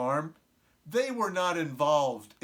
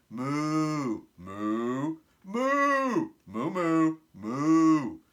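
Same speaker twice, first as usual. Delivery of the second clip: cow talk; 4 dB louder, much slower than usual. A narrator's reading. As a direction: moo like a cow, mixed pitches. Cows' meeting in cow talk.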